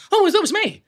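very high, sudden oh of recognition; catching yourself in a film; surprised recognition. Retold or imitated. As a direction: squeal at spotting yourself. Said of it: "I'll be watching it and go".

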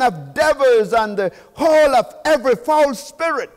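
loud, high scripture proclamation; preaching at full force. Acted. Preacher proclaiming Babylon's fall to the church, full force. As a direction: thunder it out to the congregation.

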